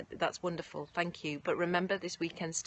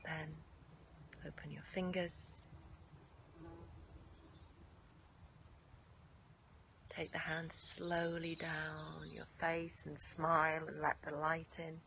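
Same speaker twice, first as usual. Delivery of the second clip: guided meditation; 9 dB quieter than usual. Acted. Leads yoga nidra meditation.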